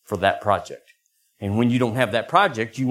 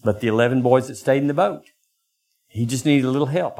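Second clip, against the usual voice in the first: usual speaking voice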